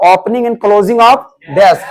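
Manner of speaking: very slow, with a rise on "of"; slow dictation. Shown as a demonstration. Teacher repeating the phrase for students, very slowly. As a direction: lay out each word slowly, lifting on "of". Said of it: "school से क्या क्या चीज का आवाज आता था बताओ?".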